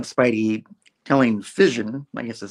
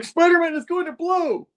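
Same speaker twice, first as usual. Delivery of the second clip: much higher alarmed warning; mock alarm; 4 dB louder than usual. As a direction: warn in a hammed-up panic. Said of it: "He's like".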